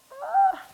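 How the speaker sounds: loud, very high "ah"; pained squeal. Real, not acted. Cook losing it over onion tears, pained squeal. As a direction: squeal out as the sting takes over.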